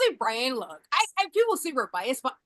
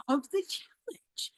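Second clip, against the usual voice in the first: ordinary delivery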